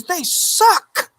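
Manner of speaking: high fed-up woman's voice; imitating an indignant friend. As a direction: mimic her cousin's wife, worked up. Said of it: "she goes".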